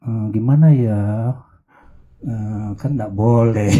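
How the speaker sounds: coy reluctance, hoping to be persuaded; playing hard to get. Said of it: "[name] kan biasa jual mahal". (jual mahal, pelan dan malu-malu)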